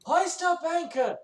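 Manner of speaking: captain's booming shout to cast off; shouted orders. Acted. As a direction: roar orders to the whole crew. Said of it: "Azure's also gonna use his turn to say".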